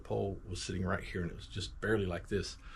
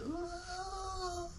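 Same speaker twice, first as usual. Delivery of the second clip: put-on piercing high child's scream; thin high wail of pain; high shriek; 9 dB quieter than usual. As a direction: shriek like a terrified kid just hit. Kid hit with shovel.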